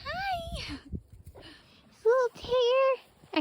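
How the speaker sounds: very high, slow cooing. Real, not acted. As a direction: coo softly at the kitty. Greeting the barn cat.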